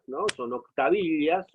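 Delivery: ordinary delivery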